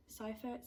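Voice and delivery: Seifert said as SIGH-fert, softly; unsure name. Shown as a demonstration. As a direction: Seifert with an eye sound, quiet guess. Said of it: "I apologize".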